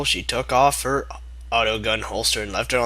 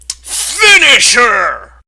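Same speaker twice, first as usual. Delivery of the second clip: much higher, louder, very slow booming call; announcer call; 11 semitones higher, 12 dB louder, much slower than usual. Retold or imitated. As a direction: boom it like a fighting game announcer. Fighting game announcer call.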